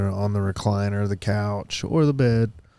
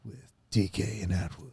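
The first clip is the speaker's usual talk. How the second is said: late night host. Pretend. Host introducing the late night talk show, smooth and hushed. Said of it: "it sounds like".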